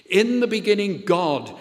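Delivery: each word of the refrain landed firmly; emphatic refrain. Acted. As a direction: declare it like a creed. Refrain repeated with rising force.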